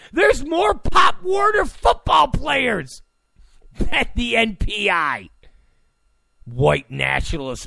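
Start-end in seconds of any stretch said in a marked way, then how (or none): 0.1-5.3 incredulous shout. Real, not acted. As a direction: yell it out in disbelief. Repeats the disbelieving point.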